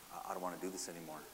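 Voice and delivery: soft, quick, half-hearted giving up; acted discouragement. Preacher voices a believer pulling back from serving, quietly discouraged. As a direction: say it low-key, as if pulling back.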